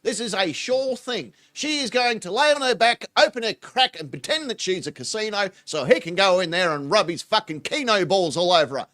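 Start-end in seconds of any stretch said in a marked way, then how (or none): none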